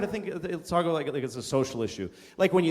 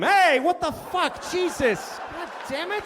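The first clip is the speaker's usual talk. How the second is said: outraged yell; 12 semitones higher, slower than usual. Acted. Startled guy at the ATM yelling in outrage. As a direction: yell it like you just got jumped.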